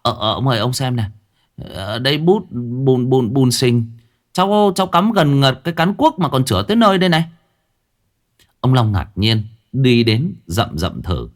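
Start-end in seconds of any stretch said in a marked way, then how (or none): 0.0-7.2 stammering. A narrator's reading. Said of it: "thằng này lắp bắp".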